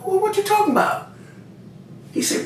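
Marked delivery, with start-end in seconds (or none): 0.0-1.1 retold bafflement. Answering homeboy's angry call, high-pitched bafflement. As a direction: squeak it up high, mock disbelief.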